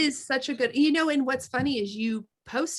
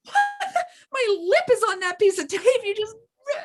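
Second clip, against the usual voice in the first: shocked disbelief; 12 semitones higher, 4 dB louder than usual. Retold or imitated. Retelling ripping tape off lip.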